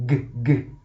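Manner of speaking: "g" as a short hard guh, repeated; letter sound. Shown as a demonstration. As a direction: sound out "g" twice, low and separate. Sounds out letter while writing.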